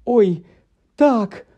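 high-pitched flustered realisation; oops, a sudden high exclamation; exclaiming. A narrator's reading. Hermione suddenly remembering she is a witch, exclaiming. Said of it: "вигукнула Герміона".

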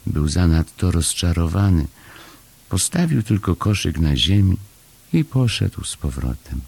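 ordinary delivery

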